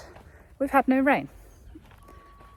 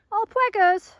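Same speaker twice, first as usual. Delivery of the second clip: much higher, louder, cooing pet name; 12 semitones higher, 7 dB louder than usual. Real, not acted. Owner cooing a pet name at her pregnant mares. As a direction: squeak the pet name fondly.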